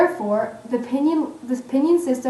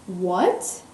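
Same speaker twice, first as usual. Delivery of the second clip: rising question; 4 semitones lower than usual. Shown as a demonstration. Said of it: "An example would be, in English".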